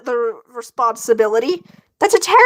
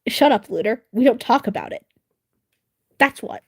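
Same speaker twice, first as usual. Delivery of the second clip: normal talking voice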